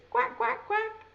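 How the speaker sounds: high quacking duck sound. Shown as a demonstration. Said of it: "we can make a duck sign like this".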